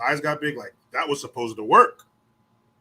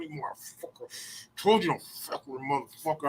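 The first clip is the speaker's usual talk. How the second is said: Ralphie style curse; 6 dB quieter than usual. Retold or imitated. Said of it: "I was like Ralphie in Christmas Story".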